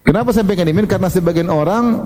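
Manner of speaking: ordinary delivery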